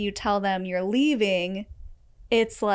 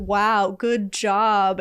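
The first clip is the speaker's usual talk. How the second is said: louder, sarcastic fake praise; imitating a scornful partner; mock sarcasm; 4 dB louder, much slower than usual. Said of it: "they're like".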